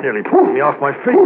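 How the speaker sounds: out of breath. Acted. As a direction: gasp it out as the dog yanks you. Dog yanking him forward.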